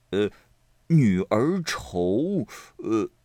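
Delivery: drawn-out words trailing into 'er'; halting stall. A narrator's reading. Xue Pan stuck on his second verse, halting. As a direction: drag it out, lost for the rest. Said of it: "又不言语了".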